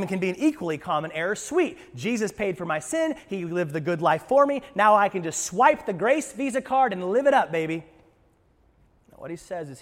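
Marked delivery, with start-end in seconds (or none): 1.3-7.8 carefree. Retold or imitated. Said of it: "he's also not saying".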